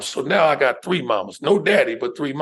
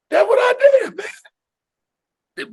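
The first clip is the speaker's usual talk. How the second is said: big, much higher shout breaking into a laugh; triumphant shout; 5 dB louder, much slower than usual. Real, not acted. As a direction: crow it out loud like you got away with it. Proud punchline about dodging insurance.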